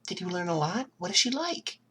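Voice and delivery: high, breathy worried mother; worried mom. Acted. Mom finding bruised Izuku on the couch, anxious and breathy. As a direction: fuss over a hurt son.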